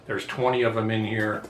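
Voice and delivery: ordinary delivery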